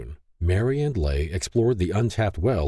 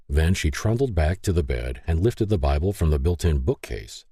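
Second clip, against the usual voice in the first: ordinary delivery, nothing marked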